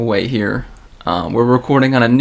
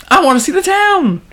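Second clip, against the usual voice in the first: high voice; 4 dB louder than usual. Acted. Reading tweet in a voice.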